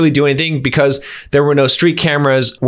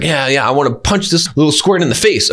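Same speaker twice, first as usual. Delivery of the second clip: usual speaking voice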